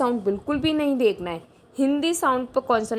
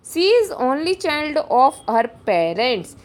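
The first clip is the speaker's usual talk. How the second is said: very slow, deliberate reading; slow dictation read; 3 dB louder, much slower than usual. Shown as a demonstration. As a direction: drag each word out for the class. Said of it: "reading exam sentence slowly".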